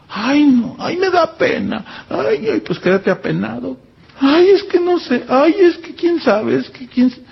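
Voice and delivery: high, whiny mock dithering; whiny excuses. Preacher mocks a shy believer avoiding God's command, whiny. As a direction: mimic a timid believer wriggling out of it.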